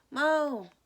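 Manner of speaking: high, loud calling out; shouting name. A narrator's reading. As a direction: call across the field. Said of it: "Coach Marie shouts".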